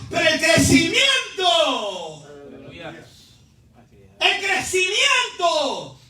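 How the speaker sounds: slow, stretched pulpit proclamation; fervent preaching. Real, not acted. Preacher proclaiming that God gives growth, fervent preaching. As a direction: draw out each cry for the congregation.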